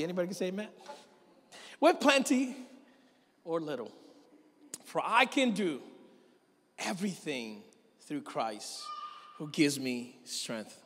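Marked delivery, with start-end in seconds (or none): 1.8-10.8 scripture reading. Acted. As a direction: read Philippians to the church with conviction. Reads scripture aloud.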